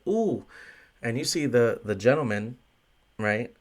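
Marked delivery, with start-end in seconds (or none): 0.1-0.5 mock shocked ooh. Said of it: "And I was like".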